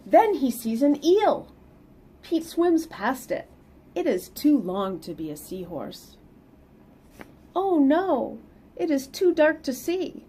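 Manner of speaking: lively read-aloud storytelling; picture book reading voice; story narration. Acted. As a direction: read it to the kids with bounce.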